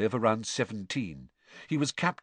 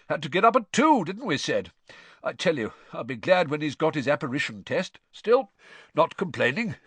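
higher, hearty character voice; jolly dad grumbling good-humouredly; chatty, good-natured father; 6 semitones higher, 4 dB louder than usual. A narrator's reading. Cedric's father chatting about the early start, hearty and good-natured.

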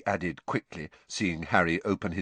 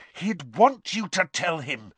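much higher, firm and serious; stern; 10 semitones higher, 4 dB louder than usual. A narrator's reading. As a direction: lay down the law. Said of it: "said Hermione sternly".

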